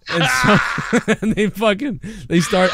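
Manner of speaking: high, laugh-choked telling; words breaking into laughter; cracking up mid-story. Real, not acted.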